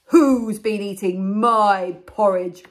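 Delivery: big, slow booming bear voice; very loud Father Bear. Acted. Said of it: "said in a very loud voice".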